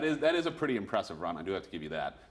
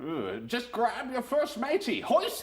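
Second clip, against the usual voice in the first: higher hammed-up pirate with laughs; 8 semitones higher than usual. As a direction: play a cheesy pirate captain. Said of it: "we're gonna just talk normal".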